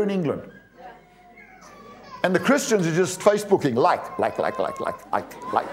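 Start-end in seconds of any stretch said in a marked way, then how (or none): 3.8-5.7 rapid-fire repeated likes, trailing softer; mock Facebook liking. Preacher mimicking Christians who only click like, mocking repetition. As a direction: mimic someone idly clicking away.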